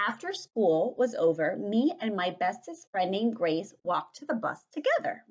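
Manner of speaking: loud, animated read-aloud; projected, lively storytelling voice; story reading to children. A narrator's reading. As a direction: read it out big for a class of children.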